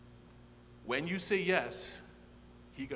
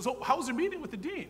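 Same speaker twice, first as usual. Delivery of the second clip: retold wife; 11 semitones higher, 4 dB louder, faster than usual. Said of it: "Tracy comes in and says".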